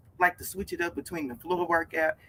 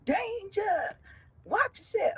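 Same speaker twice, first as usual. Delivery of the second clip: much higher, thin warning call; high mock warning; 14 semitones higher, 6 dB quieter, slower than usual. Warning her viewers in a high mock alarm voice. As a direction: play the alarm voice. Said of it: "repeated warning".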